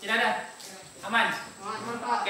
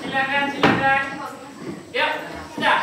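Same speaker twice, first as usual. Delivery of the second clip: louder call over classroom noise; teacher calling out; 3 semitones higher, 5 dB louder than usual. Real, not acted. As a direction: urge the pupils along in a raised voice. Directing pupils over classroom noise.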